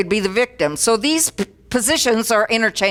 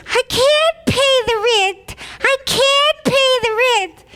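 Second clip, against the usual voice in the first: very high, slow helpless wail; damsel in distress; slower than usual. Acted. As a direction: squeak it out like a helpless heroine. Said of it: "And then the little victim".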